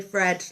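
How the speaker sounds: "Fred" said with an F sound, Liverpool style; Scouse th as f. Shown as a demonstration. Crafter explaining her Liverpool accent, "Fred" with an F sound. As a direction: say "Fred" with a plain F at the start. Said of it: "we don't tend to pronounce our T H's".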